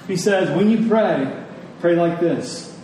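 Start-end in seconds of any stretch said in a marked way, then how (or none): none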